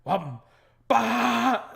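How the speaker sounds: loud mock engine burst; imitated engine roar. Imitating a friend's burnout, loud engine noise. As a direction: boom it out like a revving engine. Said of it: "I hear this".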